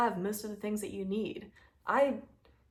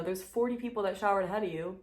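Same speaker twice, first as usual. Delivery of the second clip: normal talking voice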